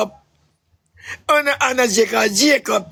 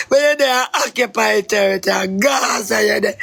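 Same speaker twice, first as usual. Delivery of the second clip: ordinary talk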